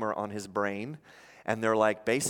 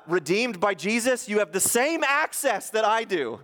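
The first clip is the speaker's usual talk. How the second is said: higher, louder preaching push; impassioned preaching; 6 semitones higher, 4 dB louder than usual. Acted. Pastor insisting all believers have the same access to God. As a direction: insist from the pulpit.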